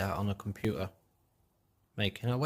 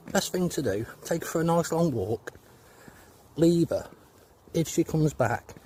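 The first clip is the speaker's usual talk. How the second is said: high doctor voice; 6 semitones higher than usual. Acted.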